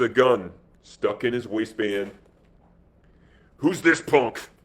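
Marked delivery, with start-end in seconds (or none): 3.6-4.5 mildly snapped question. A narrator's reading. Boss demands to know the newcomer, mildly snapped. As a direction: snap it out with a touch of irritation. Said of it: "he snapped".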